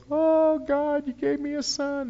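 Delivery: high thrilled Abraham thanking God; 1 Abraham overjoyed. Retold or imitated. Abraham thanking God for his son, high joyful cry. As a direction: make Abraham burst with joy up high. Abraham voiced in retold story.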